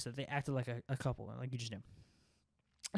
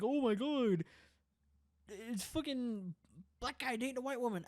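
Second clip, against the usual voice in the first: mock shocked bigot; 9 semitones higher than usual. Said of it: "or that I'm like".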